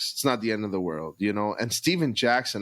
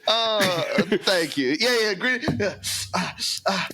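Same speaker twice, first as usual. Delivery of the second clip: mock Ja voice; 11 semitones higher than usual. Imitating Ja, comic.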